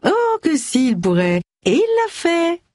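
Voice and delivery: very high, drawn-up mocking voice; gloating taunt. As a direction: taunt a rival in a lilting high pitch. Rival gloats about an affair. (ton moqueur et triomphant, très aigu)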